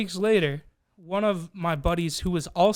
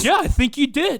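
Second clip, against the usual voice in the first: smug friend voice; 9 semitones higher, 6 dB louder than usual. Retold or imitated. Friend stubbornly confirms accusation.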